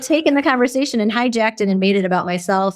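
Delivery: ordinary delivery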